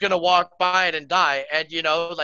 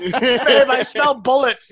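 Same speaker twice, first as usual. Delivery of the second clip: complaining neighbor; 6 semitones higher, 6 dB louder, slower than usual. Pretend. Neighbor voiced complaining to partner.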